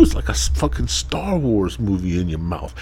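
normal talking voice

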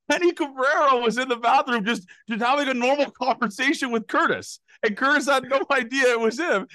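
real laughter breaking into a high voice; cracking up at the punchline; laughing while telling. Real, not acted. Host telling his co-host the bar story, laughing through it. Laughing retelling the funniest part.

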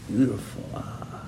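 soft, blissful sigh of admiration; dreamy praise. Acted. Praising the strip club's glamour, dreamy. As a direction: let it fade into a relaxed "ah".

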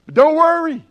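imitating a friend's Southern drawl. Preacher imitating Jim's Southern drawl to the brethren. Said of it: "he used it in a Southern drawl accent".

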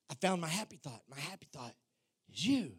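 tender confession. Retold or imitated. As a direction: tell your son he is your joy, hushed. Father tells son tenderly.

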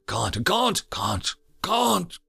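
high, strained stammer; stressed panic. A narrator's reading. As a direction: crack under the stress. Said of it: "His voice crackling with stress".

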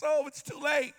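ordinary talk, nothing marked

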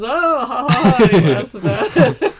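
laughing heartily as the Bendu. Retold or imitated. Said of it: "And he says".

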